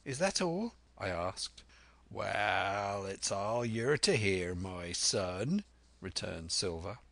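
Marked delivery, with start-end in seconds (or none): 0.0-0.7 put-on higher, questioning boy narrator; lightly voiced Jim, pitched up; young Jim asking. 2.1-5.6 slow, drawn-out pirate voice; old sea-dog talking to a lad; Silver, sly old pirate. Acted.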